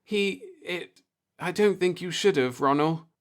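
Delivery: a touch of nervous faltering; faltering worry. A narrator's reading. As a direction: stumble over the words, anxious.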